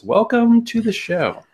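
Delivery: high, slow, stretched-out welcome; show announcer. Pretend. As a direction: welcome the guest like a big radio host. Host formally welcomes the guest.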